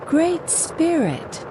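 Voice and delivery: high, slow call that fakes courage; forced brave shout. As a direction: shout up at the spirit, bluffing bravery. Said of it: "she shouted, trying to sound brave".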